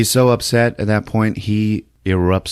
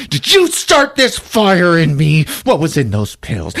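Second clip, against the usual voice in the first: mock accusing Sam; 6 semitones higher than usual.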